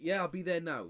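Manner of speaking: loud, carefree fake phone chat; fake phone call. Pretending to chat on the phone, loud and casual. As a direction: sound busy on the phone to scare him off.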